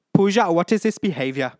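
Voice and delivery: high, loud telling-off; reality-show reprimand; loud scolding. Retold or imitated. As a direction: demand an answer from Pooja. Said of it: "This is from Big Boss".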